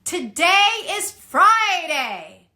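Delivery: slow, loud, calling to a big crowd; chest-voice call, slow and strong; projected announcement. Shown as a demonstration. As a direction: announce it to a full hall. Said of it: "announce that to your large group of people".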